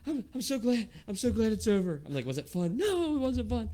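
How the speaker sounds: put-on high kid voice, loud with relief; relieved shaken kid. Son stepping off the coaster in tears, high and relieved. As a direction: gasp it out like a kid just off the ride. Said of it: "He's like".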